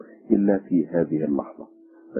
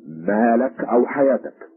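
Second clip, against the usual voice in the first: slow, raised challenge; threatening; 6 semitones higher, 4 dB louder than usual. A narrator's reading. Policeman threatening a shape by the tree, slow raised threat. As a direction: threaten him, higher and drawn out. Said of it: "فانطلق الشرطي إليه يهدده".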